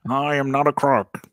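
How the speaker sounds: a light, quick imitation of a disgraced president denying it; tossed-off presidential denial, lightly put on; Nixon voice. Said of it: "what's your Nixon like?".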